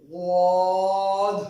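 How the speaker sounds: غاد slow and loud, throaty and heavy; غاد with a thick throaty غ; heavy Ghaad. Shown as a demonstration. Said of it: "جو کہ بہت موٹا".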